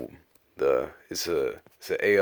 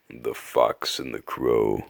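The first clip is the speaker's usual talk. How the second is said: put-on old man voice. Reader opens the last fable, slow old man narrator. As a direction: give the title out slowly as an old storyteller. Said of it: "let me get into my old man voice".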